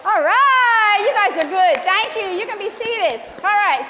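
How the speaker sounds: high, loud cheer easing off; elated praise. Real, not acted. Song leader thanking the women after singing, elated praise. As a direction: cheer them, then settle them down.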